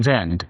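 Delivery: জেন্ট said alone as the last syllable; syllable demonstration. Shown as a demonstration. Teacher breaking up a word for students, জেন্ট sounded out alone. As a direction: sound out জেন্ট as one separate beat. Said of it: "এখানে কয়টা syllable বলো".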